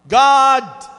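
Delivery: loud desperate cry to God upward; shouting to God. Acted. Stranded climber calling out to God, loud cry.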